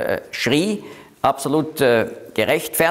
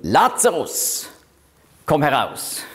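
a mild quoted command, barely raised; Jesus calling Lazarus. Retold or imitated. Teacher quoting Jesus at the tomb, a lightly voiced call. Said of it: "Jesus Christus hat hier gerufen".

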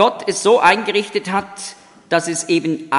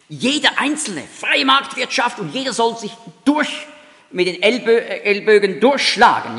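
higher-pitched, punchy mock proclamation; emphatic slogan; 5 semitones higher than usual. Capitalism answers communism that everyone must push through, emphatically. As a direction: voice a raised, insistent slogan.

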